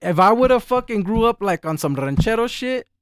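emphatic. Real, not acted. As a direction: push the point hard. Emphatic point about upbringing.

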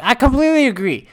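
high-pitched, wholehearted retold agreement; emphatic agreement. Telling how they reacted to the power-level complaint, emphatic agreement.

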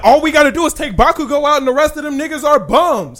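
high, loud hammed-up arrogance; cocky villain voice. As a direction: swagger it as the smug enemy. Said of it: "It was like".

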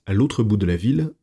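ordinary delivery

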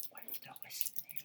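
hushed, secretive whisper. Pretend. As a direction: breathe it like a conspiracy. Fearing someone overhears them.

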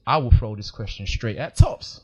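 ordinary delivery

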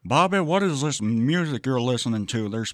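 lightly voiced cartoon dad; a hint of the cartoon father questioning his boy; King of the Hill impression. Cartoon dad asking Bobby about his music, lightly imitated. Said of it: "King of the Hill".

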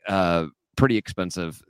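ordinary delivery

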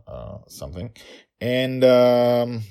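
ordinary delivery, nothing marked

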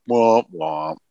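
mopey Eeyore womp womp. Acted. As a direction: droop it like Eeyore. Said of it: "that's exactly how I sound with joy".

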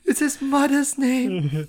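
high, loud, drawn-out wail; Amy Adams impression. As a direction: cry it out slowly in a high, melodramatic voice. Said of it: "I did the Amy Adams impression".

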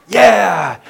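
loud, high mock cheer from the disciples; hammed-up eager yeah; excited cheer. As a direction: whoop it up like an eager crowd. Disciples excited in retold scene.

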